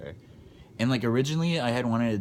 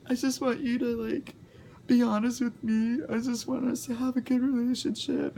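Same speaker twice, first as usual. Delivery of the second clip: much higher mock mom voice, pleading; mocking crying mom. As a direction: imitate mom tearfully begging, pitched way up.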